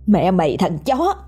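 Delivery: high, fast furious screaming at him; throat-tearing scream. Acted. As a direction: scream at him with all your hatred. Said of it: "Tiếng gào của cô vang lên xé họng".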